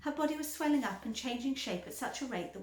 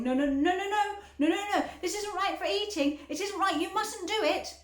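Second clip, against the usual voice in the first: higher, louder frantic protest; Mister Wonka; 9 semitones higher, 7 dB louder than usual. A narrator's reading. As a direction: plead urgently with her to stop. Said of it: "Mister Wonka was wriggling his hands".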